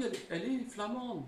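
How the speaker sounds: ordinary talk, nothing marked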